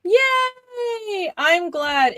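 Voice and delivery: high excited yay, bursting with joy; delighted cheer. Real, not acted. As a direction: squeal it out happily. Delighted reaction to praise.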